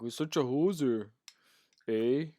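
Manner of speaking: lightly put-on Canadian accent; hint of a Canadian twang on the quote. Joking with viewers about calling people hosers, light Canadian twang. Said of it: "the Canadian twang thingy".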